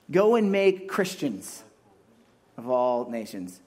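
slow mock misquote, punching "go"; wrong version, slow. Shown as a demonstration. Pastor testing the congregation with a wrong verse, slow and emphatic.

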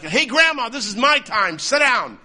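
hammed-up, loud rebuke of a heckler; mock scolding. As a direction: pretend to tell Grandma off, high and loud. Imagined telling-off of the old lady.

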